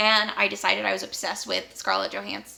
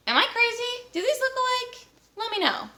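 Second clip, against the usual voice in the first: high incredulous question. Real, not acted. Asking viewers about cover similarity.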